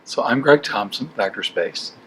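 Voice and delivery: ordinary delivery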